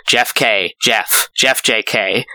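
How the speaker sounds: ordinary delivery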